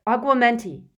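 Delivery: urgent shouted spell; Harry. A narrator's reading. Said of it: "he shouted".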